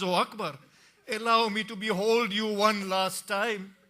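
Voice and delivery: high, loud wailing plea; grieving plea. Acted. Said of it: "a woman grabs him and says".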